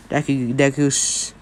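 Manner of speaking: plain reading voice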